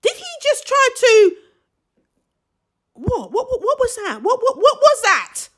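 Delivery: high, fast, laughing disbelief; stunned disbelief. Retold or imitated. Recalling hearing the boyfriend's rude remark, high laughing disbelief. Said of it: "I thought".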